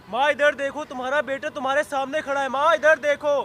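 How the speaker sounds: high, insistent calling out to mother; urgent pleading call. Acted. Son back from jail calls to his mother, urgent pleading.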